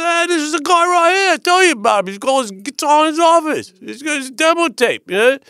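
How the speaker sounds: very high, loud Australian caricature. Acted. Singer pointing out the guy with the demo tape, high hammed-up voice. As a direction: pitch it way up and squawk it excitedly. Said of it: "Bon goes".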